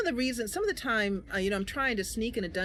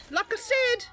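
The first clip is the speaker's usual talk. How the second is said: much higher, louder mocking threat; taunting character voice; 6 dB louder than usual. Player voicing Mercy threatening enemies, high taunting voice. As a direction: sneer at the enemy from up high.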